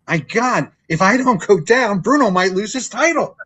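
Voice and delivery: put-on high, loud panicky little kid; a little boy fretting that his hero might lose; excited kid. Kid panicking about Bruno.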